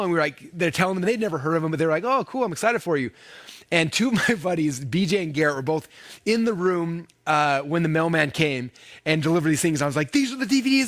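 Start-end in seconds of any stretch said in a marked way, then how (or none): 2.0-3.1 higher-pitched mock enthusiasm; retold supportive reply. Buddies answering his news, retold mild enthusiasm. 10.1-10.9 high-pitched excited announcement; retold freaking out. Showing his buddies the new DVDs, retold excitement. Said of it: "I was, like, freaking out".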